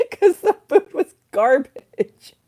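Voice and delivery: laughing. Real, not acted. Laughing complaint about pub food.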